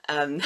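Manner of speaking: bright loud laugh about her bug obsession; burst of laughter. Real, not acted. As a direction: laugh out freely, a little self-amused. Laughs about her thing for bugs.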